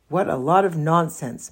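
usual speaking voice

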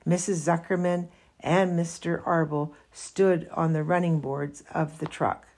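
plain reading voice

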